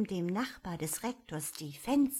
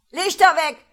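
a loud, higher barked order; shouted command in the dark; 10 semitones higher, 17 dB louder than usual. A narrator's reading. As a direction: yell to kill the lights. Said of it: "hatten die Burschen geschrien".

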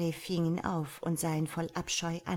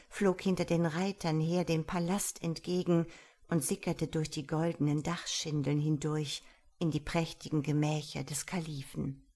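ordinary delivery

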